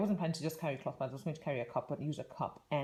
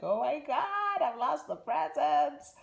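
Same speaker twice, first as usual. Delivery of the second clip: panicky message; 8 semitones higher, 4 dB louder, slower than usual. Retold or imitated. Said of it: "a really panicky message".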